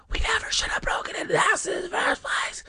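fast, breathy whisper. A narrator's reading. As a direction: hiss it out under your breath. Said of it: "Sherry whispered".

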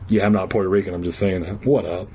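plain reading voice

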